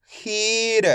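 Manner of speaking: खीर with a long ee and short final r; stretched pronunciation. Shown as a demonstration. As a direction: hold खी, then lightly release र in खीर. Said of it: "किस तरीके से अपन इसका उच्चारण करेंगे".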